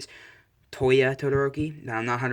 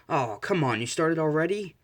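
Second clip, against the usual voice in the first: miffed protest rising higher; mock complaint; 6 semitones higher than usual. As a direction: whine lightly at being left out. Father finds party started without him.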